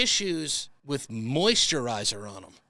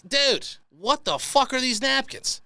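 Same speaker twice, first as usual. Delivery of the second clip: exasperated; 7 semitones higher, slower than usual. Real, not acted. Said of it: "exasperated rant".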